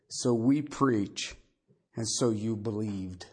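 measured, solemn Bible reading; scripture reading. Acted. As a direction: read the passage with weight and care. Reads scripture aloud.

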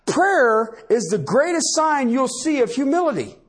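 fervent pulpit preaching; preaching at full force. Acted. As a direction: preach it hard to the room.

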